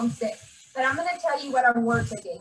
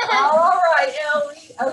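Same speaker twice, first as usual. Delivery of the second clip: louder, with a teary laugh; steady yourself with a laugh; laughing through tears; 2 semitones higher, 7 dB louder, slower than usual. Real, not acted. Teacher calls up her pupil, laughing and near tears.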